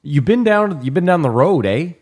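loud, high mock Canadian question. Joking about Canadians saying eh, Canadian drawl. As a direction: ham up the Canadian eh. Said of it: "It's like you ask someone".

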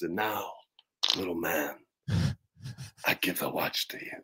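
hushed, low, halting celebrity impression; very quiet, low mock-dramatic actor voice; Christopher Walken. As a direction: imitate a bad impersonator, soft with long pauses. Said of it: "he does the Christopher Walken voice".

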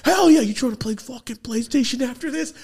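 put-on high, loud kid-like enthusiasm; excited welcome. Kid welcoming his new uncle into the family, excited shout.

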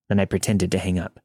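ordinary delivery, nothing marked

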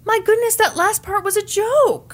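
high, flustered protest; mock flustered dad. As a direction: play it as caught out. Imitating Scott's reply.

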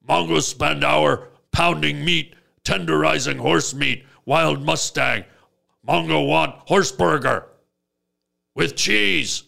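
blunt caveman craving; a simple brute wanting food; caveman talk. Retold or imitated. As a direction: caveman Mongo demanding a horse burger. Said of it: "he still talks like this".

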